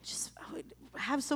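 whispered confession. Real, not acted. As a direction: say it under your breath, ashamed. Confessing resentment.

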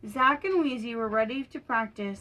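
plain reading voice

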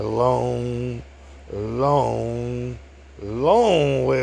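each "long" stretched out slowly; drawn-out repetition. Retold or imitated. Blind man recalling his truck route, "long" slowly drawn out. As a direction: drag out "long" three times, pausing between.

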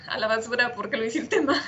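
said through a laugh. Real, not acted. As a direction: laugh ruefully through the words. Laughs over a wasted workday. (entre risas)

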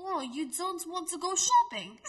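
high, loud Russian accent sliding into British; Russian accent gone British. Shown as a demonstration. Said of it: "That was British".

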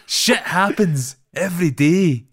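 high, loud, drawn-out mock drama; overdramatic acting. Mimicking the film's actors to a co-host, overdramatic. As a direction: ham up every word slowly. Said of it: "trying so hard to be dramatic".

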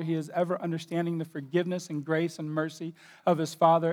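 normal talking voice

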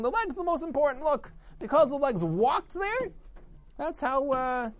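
high-pitched insistent boast; boastful arguing. A narrator's reading. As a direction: insist on your own importance. Legs boasting in acted argument.

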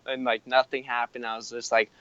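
usual speaking voice

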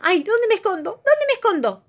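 high, loud fretful bunny voice; panicked thinking. Acted. Norberto the rabbit worrying where to hide, high panic. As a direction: scramble for a hiding spot.

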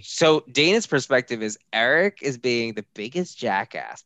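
ordinary delivery

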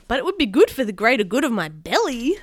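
loud, swooping protest; bouncy, emphatic objection; arguing. Acted. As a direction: argue it back like an indignant kid. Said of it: "argued Kenny".

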